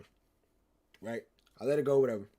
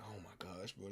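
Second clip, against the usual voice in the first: very soft, lowered murmur; nervous hush; 8 semitones lower, 20 dB quieter, slower than usual. Retold or imitated. Teller acting himself panicking over the girl, nervous hush. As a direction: keep it under your breath, nervously. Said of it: "I'm, like, nervous or whatever".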